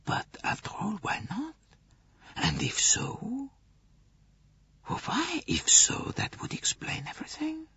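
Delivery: soft, high whispered murmur; murmured beneath breath. A narrator's reading. Poirot musing alone, a breathless high whisper. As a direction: breathe it to yourself in wonder. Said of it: "He murmured beneath his breath".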